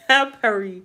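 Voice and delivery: Paris as pɛ˞i, S dropped, after a laugh; French Paris. Shown as a demonstration. Streamer to chat, playfully saying Paris in French. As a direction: say Paris with a silent S. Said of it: "a hat on my head saying Paris".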